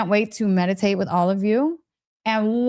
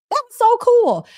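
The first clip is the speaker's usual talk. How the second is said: excited; 11 semitones higher, 6 dB louder than usual. Real, not acted. Excited about her experiment.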